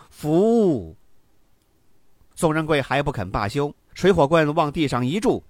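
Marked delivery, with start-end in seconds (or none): 0.1-0.9 lightly acted chorus reply; crowd answering in unison. A narrator's reading. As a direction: call it out as a submissive crowd would. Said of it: "于是齐声说".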